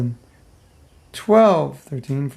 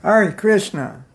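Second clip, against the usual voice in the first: fed-up Hare Krishna; 5 semitones higher, slower than usual. Shown as a demonstration. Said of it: "Have you ever said".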